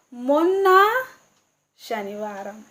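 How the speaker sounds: ordinary delivery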